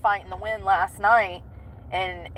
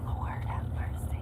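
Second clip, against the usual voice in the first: slow, breathy plea; tired prayerful sigh; weary whisper; 8 dB quieter, slower than usual. Real, not acted. Sore, weary groan.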